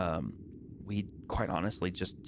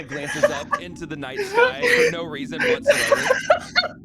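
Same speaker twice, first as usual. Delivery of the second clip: laughing narration; 11 dB louder, slower than usual. Real, not acted. GM cracks up mid narration.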